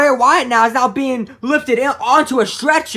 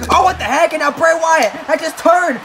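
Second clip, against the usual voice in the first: raised, urgent play-by-play; excited wrestling commentary; 8 semitones higher, 4 dB louder than usual. Acted. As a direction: call the sudden turnaround as it happens. Calls the match.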